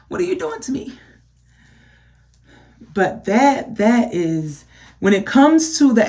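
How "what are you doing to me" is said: exasperated. Real, not acted. Exasperated at the wig.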